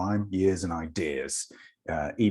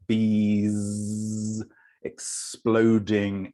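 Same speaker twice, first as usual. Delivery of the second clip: drawn-out buzzing bees; much slower than usual. Shown as a demonstration. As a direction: stretch BEES into a long buzz, then draw out EXPLODING. Mimics bees bursting from hive.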